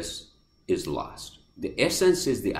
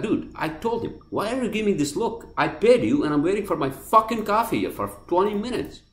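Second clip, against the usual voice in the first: higher, fast, heated retort; indignant complaint; 11 semitones higher than usual. Retold or imitated. Retelling his complaint to the cashier, higher and fast. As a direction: throw the grievance right back at him.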